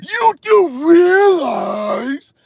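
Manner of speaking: loud, high, hugely stretched mocking cry; mock know-it-all expert. Streamer mimicking what experts always say, exaggerated mocking voice. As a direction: wail it slowly to ridicule the expert. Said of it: "They always gotta say".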